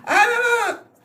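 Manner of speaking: high-pitched, put-on refusing to listen; mock refusal. Acts out refusing child's English.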